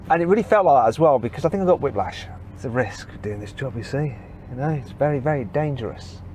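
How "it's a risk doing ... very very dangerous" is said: mock-serious aside. Joking aside after whiplash.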